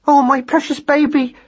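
very high, doting mother's cry. A narrator's reading. Mother finding her baby alive, high doting cry. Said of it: "cried Madeline".

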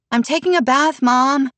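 high-pitched call from another room. A narrator's reading. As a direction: call through the door to mom. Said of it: "Mia called from the bathroom".

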